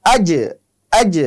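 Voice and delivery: আজ with the জ released in a small echoing bounce; bouncing j. Shown as a demonstration. Teacher demonstrating qalqala on জিম. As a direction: let the জ of আজ rebound. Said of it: "একটু bounce খাবে".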